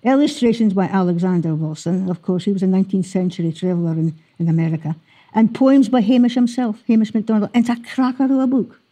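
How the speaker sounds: ordinary talk, nothing marked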